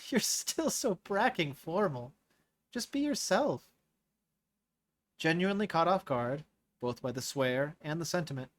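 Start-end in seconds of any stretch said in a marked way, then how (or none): 0.1-3.7 put-on higher, slow, laughing teen voice; laughing girl. Moira teases Naira after crying, laughing and high. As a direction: tease her friend through tears and a laugh. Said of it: "Moira let out a laugh".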